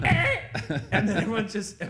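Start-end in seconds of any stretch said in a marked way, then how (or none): 0.0-0.4 high, loud imitated record scratch; record scratch noise. Crowd falling silent like a DJ scratching a record, a scratch noise. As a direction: make the scratch sound of a stopped record. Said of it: "DJ scratches a record".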